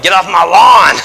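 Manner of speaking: hammed-up shout at the kids to leave; mock grumpy yell. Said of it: "yell at everyone".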